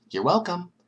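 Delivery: high, bright mock-cheerful you're welcome. Mastermind gloats to viewers about hero deaths, cheerfully. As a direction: toss it off gleefully like a smug villain. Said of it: "he said cheerfully".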